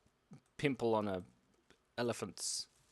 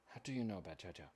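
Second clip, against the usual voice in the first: quiet repeat; 7 semitones lower, 10 dB quieter, much faster than usual. Real, not acted. Musing to herself over the chat's question, softly. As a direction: echo the question softly, under the breath.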